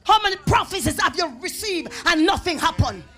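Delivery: loud, driving pulpit delivery; forceful preaching. Real, not acted. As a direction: press the question on the congregation.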